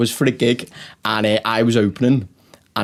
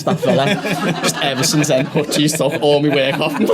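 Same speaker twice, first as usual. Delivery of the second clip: higher, rueful tale through laughter; laughing; 5 semitones higher than usual. Real, not acted. Comic telling co-host his mate took his work, laughing. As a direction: laugh at your own bad luck.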